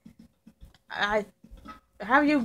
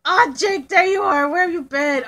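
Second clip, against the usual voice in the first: excited high greeting; 6 semitones higher, 6 dB louder than usual. Pretend. Finally finds Jake in game.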